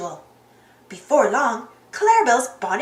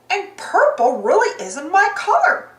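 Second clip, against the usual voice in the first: higher, swooping lady-cow voice; put-on higher character voice; Clarabelle character voice; 5 semitones higher, 4 dB louder than usual. A narrator's reading. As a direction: play Clarabelle with swooping pitch. Clarabelle voiced in read story.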